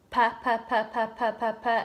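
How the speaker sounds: pa, pa, pa said as quick repeated syllables; repeated pa demo. Shown as a demonstration. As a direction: tap out "pa" rhythmically. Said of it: "I want you to say".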